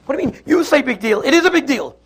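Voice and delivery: high, indignant retort; escalating quarrel. Acted. Wife objects to husband dismissing her dress crisis, acted indignation. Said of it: "the tempo and the voices get louder and louder".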